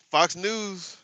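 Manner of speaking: normal talking voice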